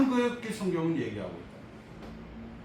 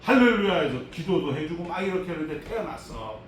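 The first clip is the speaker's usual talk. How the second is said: ordinary delivery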